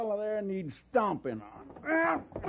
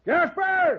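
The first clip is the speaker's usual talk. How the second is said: loud shout across the saloon; calling out; 8 semitones higher, 8 dB louder than usual. Acted. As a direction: bellow for the barman by name. Calling the barman.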